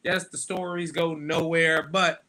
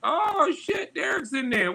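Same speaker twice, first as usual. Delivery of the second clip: surprised; 12 semitones higher than usual. Real, not acted. Friend suddenly appears on stream.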